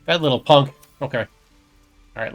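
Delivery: ordinary talk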